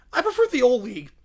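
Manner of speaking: ordinary talk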